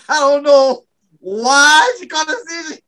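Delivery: laughing through the punchline; can barely get it out for laughing. Real, not acted. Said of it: "say my punchline without laughing".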